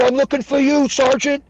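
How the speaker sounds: high, loud, earnest holler; high-pitched soldier's yell; retold shouted reply. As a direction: imitate a young private calling back. Private shouts back to sergeant.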